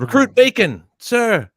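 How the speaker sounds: very high, loud military reply; recruit's shouted answer. Retold or imitated. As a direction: bellow your name to the instructor. Recruit answering drill instructor.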